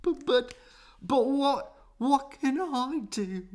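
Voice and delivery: high, stuttering through tears; sobbing stammer. A narrator's reading. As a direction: choke out the words while crying. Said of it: "The crocodile began to cry too".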